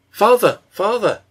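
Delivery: high, loud cry of Father!; excited shout. A narrator's reading. Sick child calling her father, shouting excitedly. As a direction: call out to him with sudden joy. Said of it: "shouted Mary excitedly".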